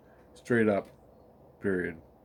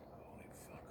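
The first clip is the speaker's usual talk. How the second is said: voiceless whispered curse; whispered aside; 29 dB quieter than usual. Real, not acted. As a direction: whisper it under your breath. Muttered aside after a pause.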